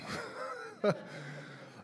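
breathy high laugh. Real, not acted. Pastor laughing at his wedding-night story. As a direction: laugh at the memory.

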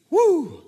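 high, loud whooping cheer; crowd cheer. Acted. Preacher voicing the crowd's cheer, a loud high whoop. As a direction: let out a joyful whoop.

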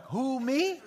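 "Who, me?" pitched high and surprised; innocent surprise. Shown as a demonstration. Teacher contrasting the right line, surprised.

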